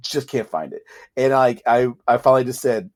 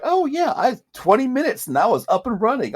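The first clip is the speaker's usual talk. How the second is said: much higher, sing-song mock cheer; mocking cheery tutor; 12 semitones higher than usual. Mocking the tutorial people who had it running fast, high chirpy parody.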